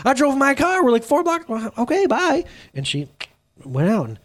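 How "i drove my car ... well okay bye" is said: high-pitched, comic disbelief; mock bewildered protest. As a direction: act out a baffled driver left behind. Retold protest as friend walks out.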